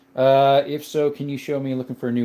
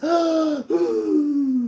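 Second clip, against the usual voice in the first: sharp high mock gasp of alarm; a quick staged gasp. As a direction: gasp in pretend shock at the chat message. Reacting to chat's cold sweat.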